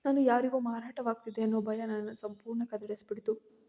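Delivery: ordinary delivery, nothing marked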